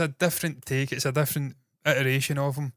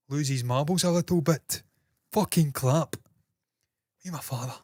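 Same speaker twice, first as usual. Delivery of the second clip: befuddled Robert Carlyle. Real, not acted. Streamer talking in a befuddled Robert Carlyle voice. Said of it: "Don't know why I'm speaking like this".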